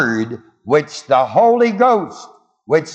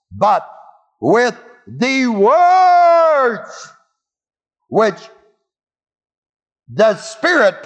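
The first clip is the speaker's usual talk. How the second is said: "with the words" drawn out very slowly, high and shouted; supplied words emphasized; 8 semitones higher, 4 dB louder, much slower than usual. Shown as a demonstration. Preacher supplying the ellipsis to his class, emphatic shout. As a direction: bellow "with the words", stretching every syllable. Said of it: "the ellipsis has to be supplied in your mind".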